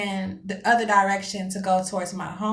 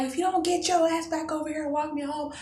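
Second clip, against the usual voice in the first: squealed, hammed-up threat; mock screaming; 8 semitones higher than usual. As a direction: imitate a tearful screamed threat without the volume. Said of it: "I'm screaming top of my lungs".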